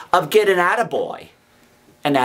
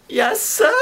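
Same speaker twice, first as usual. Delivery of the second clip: put-on frightened boy; 14 semitones higher than usual. Frightened boy answers convict.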